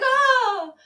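high, loud cry of hammed-up horror; horrified mock scream. Priest seeing his parents' car explode, mock horrified scream. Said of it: "look horrified, like".

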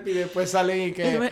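laughing through the words; can't stop laughing while telling it; giggling between words. Real, not acted.

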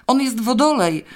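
husband yelling a jab into the call; shouted from the side. A narrator's reading. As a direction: shout it at the phone from across the room. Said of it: "wrzasnął Zbyszek z boku".

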